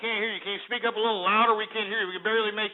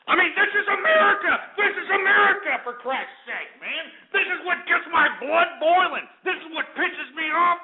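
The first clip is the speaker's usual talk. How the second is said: furious radio-host tirade; angry rant; 11 semitones higher than usual. Real, not acted. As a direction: shout it in exasperated outrage. Host rants in outrage.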